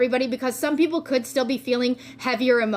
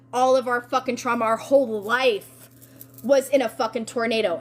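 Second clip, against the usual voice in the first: normal talking voice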